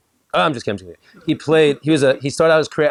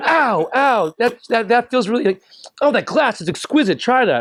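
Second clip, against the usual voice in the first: high, hammed-up yelps of pain; playful mock suffering; 10 semitones higher than usual. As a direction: fake hurt turned to delight. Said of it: "It's like".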